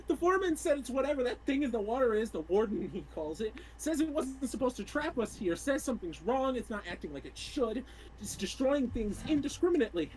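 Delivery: panicked, rising to tearful pleading; a doomed worker begging his mama; frightened young engineer, voice breaking into sobs; desperate trapped dock worker. Acted. Player reads a doomed worker's log.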